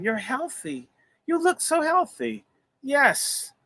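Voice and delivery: high, chirpy imitation of a doctor reassuring him; imitating the doctors. Telling a patient he looks healthy, in a high mock voice. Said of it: "as the doctors kept saying".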